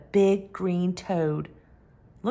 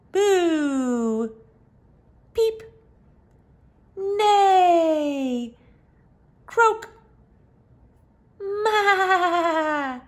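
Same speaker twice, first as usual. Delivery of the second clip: animal sounds; 9 semitones higher, much slower than usual. Acted. Animals cheering at the end.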